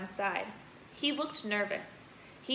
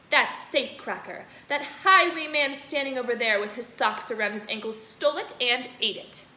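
furious headmistress; 3 semitones higher, 6 dB louder than usual. Acted. Headmistress ranting at Bruce about her cake, loud. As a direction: rage about the stolen cake. Trunchbull's long rant.

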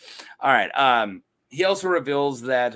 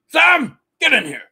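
much higher and louder call; mock call out; 11 semitones higher, 9 dB louder than usual. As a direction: summon him from the doorway like Doc Brown. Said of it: "He's like".

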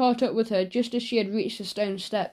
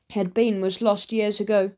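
ordinary delivery, nothing marked

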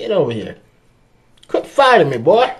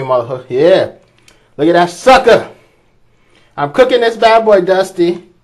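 ordinary talk, nothing marked

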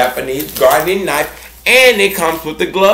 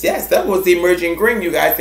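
ordinary delivery

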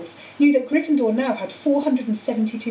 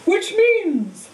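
higher, louder call over the cheering crowd; calling over applause; 9 semitones higher, 4 dB louder than usual. A narrator's reading. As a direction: raise the voice above the applause. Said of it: "Dumbledore called over the storm of applause".

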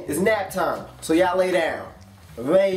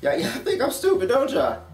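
ordinary talk

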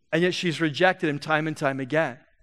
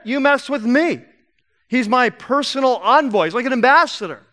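loud, high, fighting indignation; indignant listener; 4 semitones higher, 6 dB louder than usual. Acted. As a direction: step in loudly to defend the messenger. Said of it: "if I'm there and Jesus telling the story".

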